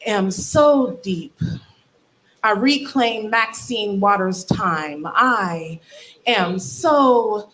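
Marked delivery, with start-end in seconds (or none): 0.1-1.4 slow, drawn-out, self-important; slow mock profound. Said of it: "in this tone of voice". 5.1-7.5 very slow, drawn-out refrain; slow mock profound. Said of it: "in this tone of voice".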